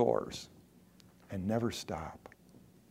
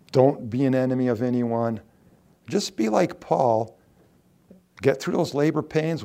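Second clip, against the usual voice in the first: ordinary delivery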